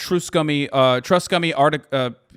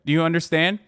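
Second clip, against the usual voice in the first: ordinary talk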